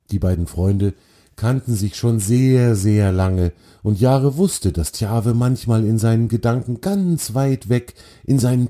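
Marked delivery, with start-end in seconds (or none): none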